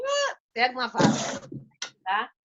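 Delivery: normal talking voice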